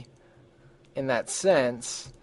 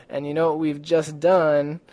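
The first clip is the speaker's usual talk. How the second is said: usual speaking voice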